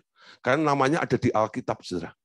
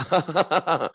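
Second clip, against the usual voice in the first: laugh; 4 semitones higher than usual. Retold or imitated. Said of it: "Lalu saya ketawa".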